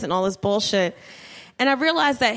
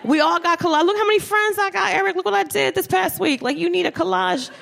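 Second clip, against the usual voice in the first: put-on higher, pushy, teasing boyfriend pitch; pushy hype; 5 semitones higher than usual. Nagging Eric to make a collage, excited and teasing. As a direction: hype up the collage like a best friend. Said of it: "I was like".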